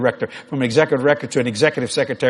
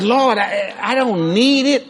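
pleading shout; 8 semitones higher, much slower than usual. Retold or imitated. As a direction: shout it up to heaven, slow and pleading. Protesting to the Lord.

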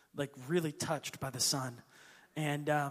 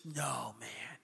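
put-on low, soft awed old man's voice; hushed old man; 7 semitones lower, 4 dB quieter, slower than usual. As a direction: drop low and slow in wonder. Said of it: "And he goes".

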